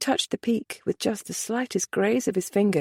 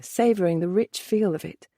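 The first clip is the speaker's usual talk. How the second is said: usual speaking voice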